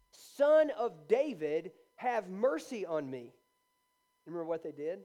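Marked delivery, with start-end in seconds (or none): 0.1-3.3 loud, drawn-out retold plea; crying out. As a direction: call out slowly for mercy, voice raised. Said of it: "he cries out and he says".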